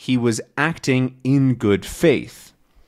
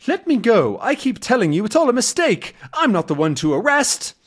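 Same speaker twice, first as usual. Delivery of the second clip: pleading protest; 10 semitones higher than usual. Acted. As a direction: plead to be let go. Tintin protests being seized.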